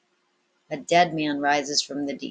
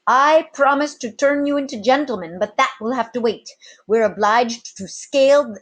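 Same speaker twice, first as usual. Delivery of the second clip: higher, louder captain's bold promise; 6 semitones higher, 4 dB louder than usual. A narrator's reading. Captain rallying his sailors against the bandits, boldly. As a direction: rouse the men with swagger.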